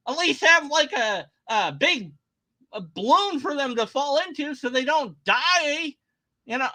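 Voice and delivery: a high pleading shout that almost cracks. Pretend. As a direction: beg them to stop, half outraged and half pleading. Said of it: "And I'd be like".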